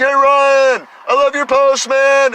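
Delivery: high, loud friendly call from across the street; buddy calling out. Retold or imitated. Buddy hails him from his car, high and loud. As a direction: shout it like a buddy pulling out of his spot.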